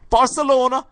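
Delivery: very high, loud ecstatic commentary; hammed-up Geordie football commentator; Ray Hudson. Imitating the announcer's analogies for Barcelona, excited Geordie shout. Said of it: "the colloquialisms or the analogies".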